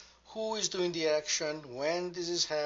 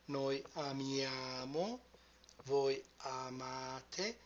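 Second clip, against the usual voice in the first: "amiamo" stressed on MIA and "amate" stressed on MA, drawn out slowly; slow stress demo; 5 dB quieter, slower than usual. Shown as a demonstration. Teacher slowing down so students hear the stress, slow and deliberate. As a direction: stretch each word so the stressed syllable stands out. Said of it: "Pay attention to the position of the stress".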